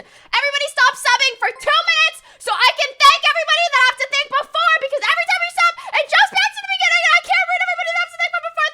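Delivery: fast, high-pitched screamed rant; exasperated screaming. Real, not acted. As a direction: scream at chat in one breathless rush.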